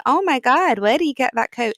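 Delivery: put-on a light American accent on the quote; a Yorkshire woman trying an American accent. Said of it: "Excuse the American accent".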